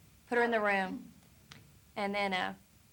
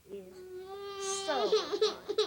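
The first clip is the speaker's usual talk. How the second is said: laughing, pitched way up; 11 semitones higher, much slower than usual. Real, not acted. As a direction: laugh through the words. Caller laughs at the situation.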